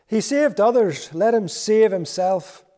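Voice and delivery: high, loud mocking jeer; sneering rulers mocking loudly. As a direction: jeer at him from below the cross. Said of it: "the rulers even sneered at him".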